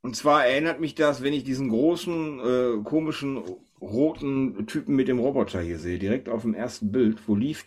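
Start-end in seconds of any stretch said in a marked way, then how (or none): none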